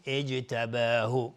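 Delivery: "اجتباه" very slow, opening on glottal i; fresh start. Shown as a demonstration. Teacher shows starting here, "اجتباه" slowly as ijtabahu. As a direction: begin "اجتباه" afresh: ijtabahu, drawn out.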